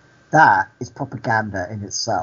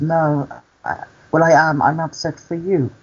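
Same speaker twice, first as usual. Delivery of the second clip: higher-pitched mock upset voice; imitating upset; 5 semitones higher than usual. Storyteller voices the cleaner admitting her worry, imitated upset. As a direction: play her hesitant, pitched-up distress. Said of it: "you sound like you're upset".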